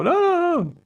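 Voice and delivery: very high, squealing no's of a doomed man; hammed-up terror, echoing the scene; mock panic. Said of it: "The suave guy trying to cut a deal".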